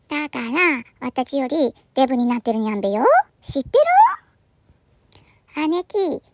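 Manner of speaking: ordinary delivery, nothing marked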